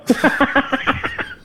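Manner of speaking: high burst of laughter; laughs. Real, not acted. Laughing at the intro.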